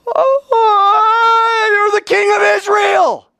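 high, loud astonished cry; amazed shout. Acted. Nathanael shouting in wonder at Jesus. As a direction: burst out in sudden amazement.

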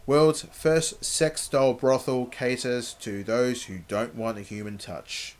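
ordinary talk, nothing marked

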